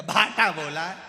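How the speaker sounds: loud, irritated answer; patient answering back in anger; angry loud retort. Acted.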